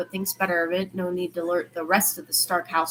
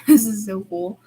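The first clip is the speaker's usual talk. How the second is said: a laugh then louder gleeful burst; laughing, excited; 7 dB louder than usual. Acted. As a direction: bubble over with delight.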